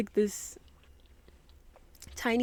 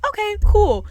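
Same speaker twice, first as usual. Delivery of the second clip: sarcastic; 12 semitones higher, 10 dB louder than usual. Retold or imitated. Mock casual reply, loud.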